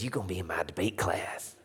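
whispered Cajun insistence; low, hushed Cajun teacher; Miss Billingsley. Retold or imitated. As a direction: insist under your breath in a Cajun accent. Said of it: "She had a strong Cajun a-, accent".

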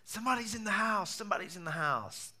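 high alarmed cry of warning; startled shout in the night; retold yell. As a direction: call out the alarm through the house. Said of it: "hearing the missionary yell".

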